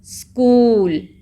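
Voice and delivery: स्कूल slow and loud, oo held; long oo. Shown as a demonstration. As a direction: stretch the vowel in स्कूल. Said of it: "इसमें long sound है double ओ की".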